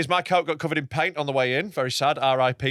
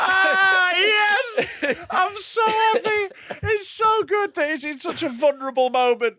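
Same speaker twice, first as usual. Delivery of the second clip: gleeful delight; slower than usual. Acted. Co-host relishing his friend's awkward moment, gleeful high squeal. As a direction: revel in it, squealing with mock rapture.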